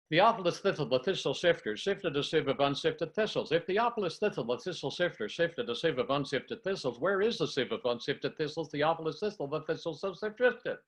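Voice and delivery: the full twister on a single breath; in one breath. Shown as a demonstration. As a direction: keep going without a breath break. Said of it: "so I'll do it in one breath".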